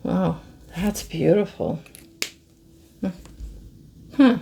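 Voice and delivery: ordinary talk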